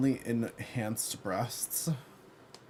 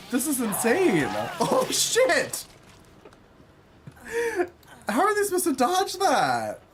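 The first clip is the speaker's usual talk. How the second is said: much higher and louder, laughing, shocked cries; excited yelling; 12 semitones higher, 6 dB louder, slower than usual. Real, not acted. Trying the new character's fiery chainsaw, shrieking with excitement. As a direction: shriek in delighted disbelief at the chaos.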